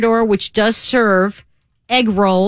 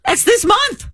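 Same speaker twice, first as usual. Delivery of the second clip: excited gasp; 7 semitones higher than usual. Real, not acted. Excited about Dairy Queen opening.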